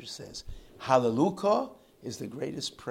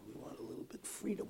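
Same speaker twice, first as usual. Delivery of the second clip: usual speaking voice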